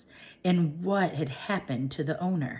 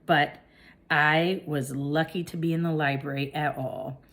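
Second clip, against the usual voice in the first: usual speaking voice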